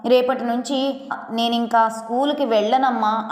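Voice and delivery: ordinary delivery, nothing marked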